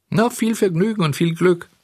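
high syrupy coachman voice; mock well-wishing coachman. A narrator's reading. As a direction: tease him sweetly as he refuses. Coachman mockingly wishes luck.

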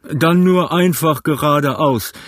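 slow loud shouted command; shouting. A narrator's reading. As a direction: bellow the order over the noise. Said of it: "schrie der Kapitän".

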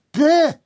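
loud, high "Bäh" of disgust; cat gagging at the food; disgusted retch. Acted. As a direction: spit it out in revulsion. Cat tastes soy food.

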